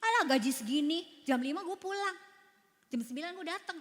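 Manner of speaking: grudging complaint. Retold or imitated. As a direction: grumble like a lazy employee. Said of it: "Kalau kemarin saya gini".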